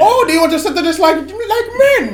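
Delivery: high, excitable Irish caricature; mock Irish accent. Clowning about fighting Irishmen, high mock Irish lilt. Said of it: "I don't fuck with that accent".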